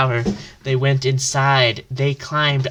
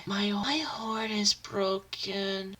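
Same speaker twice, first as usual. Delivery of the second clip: soft, slow, dejected voice; sad and quiet; 6 semitones higher, 11 dB quieter, slower than usual. Acted. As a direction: say it heartbroken and hushed. Hero sad about horn.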